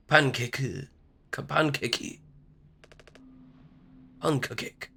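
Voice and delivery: playing with "Pancake" in a put-on Japanese accent; mock Japanese accent. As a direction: try out an imitated Japanese accent, softly. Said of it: "was I supposed to read it like that?".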